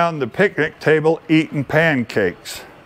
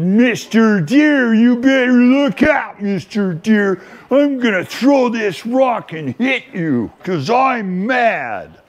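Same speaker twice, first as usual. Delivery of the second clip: put-on little boy's threat; 5 semitones higher than usual. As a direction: puff up like an angry four-year-old. Four-year-old threatening the deer.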